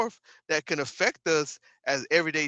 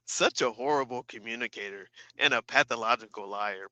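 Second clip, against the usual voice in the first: usual speaking voice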